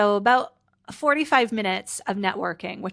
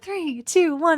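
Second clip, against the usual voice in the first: countdown; 8 semitones higher, 5 dB louder, slower than usual. Retold or imitated. Crowd counting down on TV.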